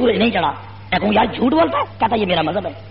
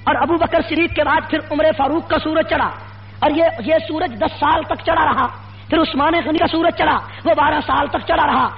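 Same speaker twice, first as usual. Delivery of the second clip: high-pitched, relentless rally oratory; fiery preaching; 5 semitones higher than usual. Real, not acted. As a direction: build accusation on accusation in rising, driving phrases. Preacher builds a fiery address. (جوشیلی، بلند سُر کی خطیبانہ للکار)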